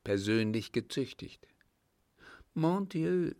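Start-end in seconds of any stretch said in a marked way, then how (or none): 2.5-3.3 sudden high frightened exclamation; startled cry. A narrator's reading. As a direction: cry out in alarm, pitched high. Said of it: "rief sie erschrocken".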